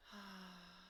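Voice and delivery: long, soft audible exhale; deep sigh. Shown as a demonstration. Coach leading listeners through a breath, long sigh. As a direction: let the breath out in one slow sigh. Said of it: "Big deep breath".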